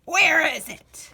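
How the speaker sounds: put-on loud, cracked old man's demand; old man demanding the pumpkin; cranky old man. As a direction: press him like a grumpy ancient.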